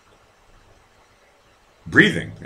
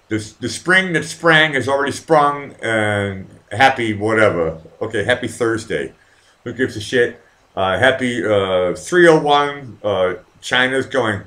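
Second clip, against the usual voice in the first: normal talking voice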